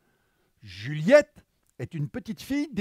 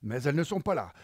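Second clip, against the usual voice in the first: plain reading voice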